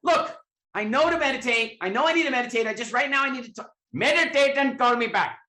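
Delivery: high, loud outraged protest; hammed-up indignation at a friend; indignant shout. [name] protesting to Deepak, high loud indignant shout.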